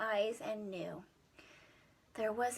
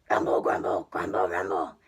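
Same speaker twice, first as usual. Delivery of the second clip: very low, loud rumbling beast voice; monster grumble; 6 dB louder, faster than usual. Acted. Storyteller voicing the hungry monster's deep rumble. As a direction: rumble it out like a big-bellied beast.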